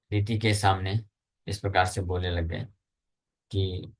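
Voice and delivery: ordinary talk, nothing marked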